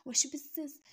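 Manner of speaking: loud high angry yell; furious shout. A narrator's reading. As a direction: snap it at the daughter. Mother yells at sobbing daughter.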